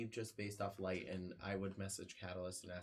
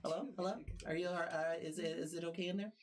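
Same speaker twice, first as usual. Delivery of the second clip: high, quick telepathic bird voice; chirpy bird creature in your head; bird person voice; 8 semitones higher than usual. Acted. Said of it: "bird person voice inside of your head".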